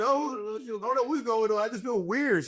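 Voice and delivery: high, teary voice breaking up; crying. Retold or imitated. Retelling his mom's video, voice high and teary. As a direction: whine it out like you're about to cry. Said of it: "Why you crying?".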